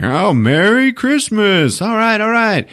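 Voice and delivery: high, slow hammed-up dad voice; lightly voiced cheery dad; mock jolly father; imitating dad. Dad voiced in movie retelling.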